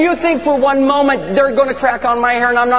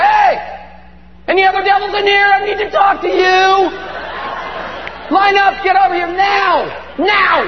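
cocky shouted challenge; 9 semitones higher than usual. Acted. Peter calls out devils to line up, cocky shouting. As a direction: shout orders like a swaggering brawler. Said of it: "He's like".

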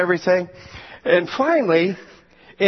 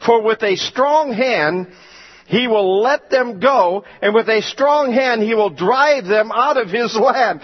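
scripture preaching; 4 semitones higher than usual. Acted. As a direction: declaim Moses' complaint from the pulpit. Preaches scripture at full force.

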